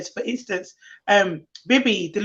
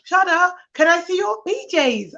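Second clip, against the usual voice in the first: put-on eager child; 7 semitones higher than usual.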